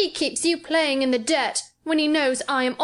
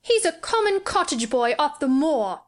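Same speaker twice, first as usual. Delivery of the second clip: put-on higher, scornful boy; sneering; 7 semitones higher than usual. A narrator's reading. Sick boy belittles girl's friend, sneering. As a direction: snobbish childish contempt. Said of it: "Colin sneered ferociously".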